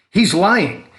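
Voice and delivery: high, loud accusing cry; cried out. A narrator's reading. Boy cries out to neighbor against his father. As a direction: shout the accusation in alarm. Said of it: "Mitch cried".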